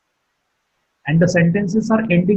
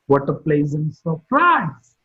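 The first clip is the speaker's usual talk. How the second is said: slow exclamation, "surprise" swoops up then falls; falling tone on surprise; 3 semitones lower, slower than usual. Shown as a demonstration. Teacher demonstrating an exclamation for learners, falling tone on "surprise". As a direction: lift "surprise" and drop its pitch. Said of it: "Surprise is marked with a falling tone".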